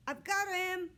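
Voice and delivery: high, loud triumphant shout; girl grabbing the dog, shouting in triumph; triumphant cry. Acted. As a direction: crow it out in victory. Child grabs dog triumphantly.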